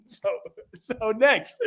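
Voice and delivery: normal talking voice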